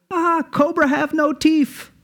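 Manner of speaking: very high, slow, put-on Indian accent; snake charmer accent. Charmer tells the bitten tourist, high slow Indian accent. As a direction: drawl it out like an amused local charmer. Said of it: "he goes".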